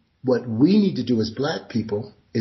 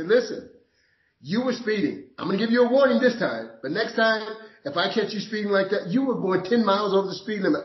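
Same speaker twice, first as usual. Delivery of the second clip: higher, quick, friendly officer giving a warning; amused police officer; 9 semitones higher than usual. Retold or imitated. Police officer telling the speeding driver, amused and lenient. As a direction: play the cop letting him off lightly. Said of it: "They say".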